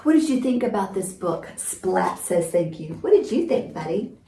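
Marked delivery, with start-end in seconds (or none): none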